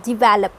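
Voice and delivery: develop as dih-VEL-up; light "di", strong "vel" in develop; stress on second syllable. Shown as a demonstration. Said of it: "आपको वीई पे ज़्यादा जोर देना है".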